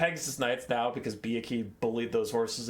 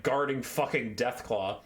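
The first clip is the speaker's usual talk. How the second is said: ordinary talk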